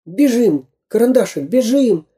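high, anxious urging; urgent alarm. A narrator's reading. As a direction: hurry your little friend away. Urging friend to flee.

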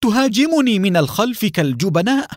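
high-pitched, indignant outburst; heated accusation, pitch leaping up; angry cry. A narrator's reading. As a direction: cry out in outrage at the attacker. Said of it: "وهو يصيح بغضب".

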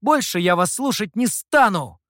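loud, high refusal; fed-up, defiant outburst; angry defiance. A narrator's reading. Youth defies threatening master.

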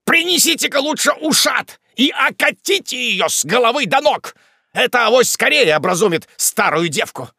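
high, exasperated ranting; irritable shout. A narrator's reading. Wardle wants his fainted sister doused, irritable shout. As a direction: rant at full pitch, easing slightly at the end. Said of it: "кричал раздражительный Уардаль".

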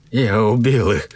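shaken, voiced confession; trembling voice. A narrator's reading. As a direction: say it aloud with a tremble. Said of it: "более громко произнёс я дрожащим голосом".

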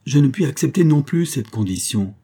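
a passionate refusal thrown back; vehement outcry. A narrator's reading. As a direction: cry out the refusal with force. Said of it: "s'écria l'abbé avec véhémence".

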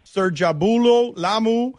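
attempted Congolese accent, slow; slow, careful Congolese accent. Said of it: "summon my best Congolese accent".

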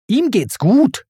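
high, irritated retort; testy, high-pitched comeback; Timon snapping. A narrator's reading. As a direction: snap back dismissively. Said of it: "fauchte Timon".

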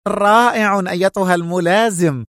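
high excited praise; trembling delight. A narrator's reading. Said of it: "وانتقل الارتعاد إلى صوته".

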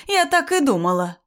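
high, loud triumphant cry; shouting. A narrator's reading. Mother-in-law announcing her suspicion confirmed, shouting. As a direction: cry out accusingly for all to hear. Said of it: "закричала Раиса Ивановна на всю квартиру".

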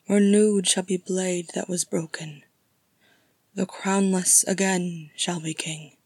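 measured verse, slightly lifted; poem recitation. Acted. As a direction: recite each line with weight.

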